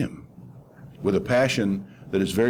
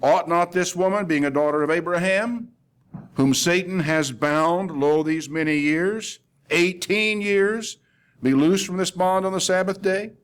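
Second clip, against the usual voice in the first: high, sustained questioning, "eighteen years" drawn out; rhetorical question; 7 semitones higher than usual. Retold or imitated. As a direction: build the question slowly, lifting "eighteen years". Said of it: "Then he asked this question".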